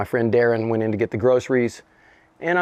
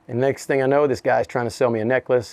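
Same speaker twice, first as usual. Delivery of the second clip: usual speaking voice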